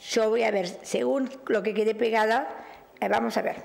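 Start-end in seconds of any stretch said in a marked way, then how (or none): none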